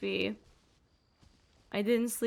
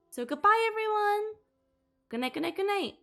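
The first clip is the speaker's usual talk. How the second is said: higher sing-song goodbyes; cheery sign-off; 9 semitones higher than usual. Real, not acted. Streamer saying good night to viewers, a light sing-song farewell. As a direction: wave the chat off brightly.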